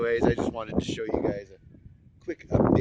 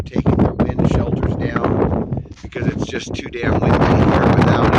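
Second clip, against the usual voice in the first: raised voice fighting the wind; shouting over wind; 8 dB louder than usual. Real, not acted. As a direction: push the words out over the wind noise. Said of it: "If you can even hear me over the wind".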